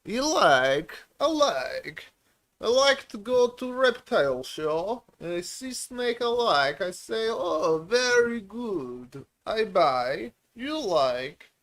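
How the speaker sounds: high, hammed-up made-up foreign accent in broken English; goofy made-up accent. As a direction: clown around in a silly foreign accent to make his wife laugh. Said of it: "I don't know what accent it is".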